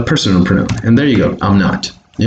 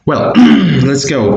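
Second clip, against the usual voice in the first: usual speaking voice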